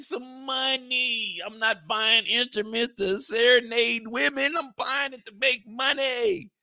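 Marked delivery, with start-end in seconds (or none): none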